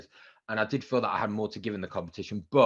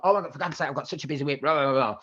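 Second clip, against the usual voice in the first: higher-pitched, exasperated retold complaint; exasperated protest; 4 semitones higher than usual. Presenter protesting to agent in the rain, exasperated. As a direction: plead that you're far too busy.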